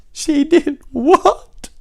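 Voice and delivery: very high, loud, drawn-out wail; hammed-up shrieking sob of disbelief; wailing cry. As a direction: cry it out as a weeping, shocked king. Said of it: "cried the king. So it's more like".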